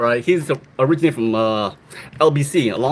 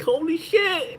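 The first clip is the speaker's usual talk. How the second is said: shocked exclamation; 13 semitones higher, 5 dB quieter, slower than usual. Retold or imitated. Shocked reaction to friend's story.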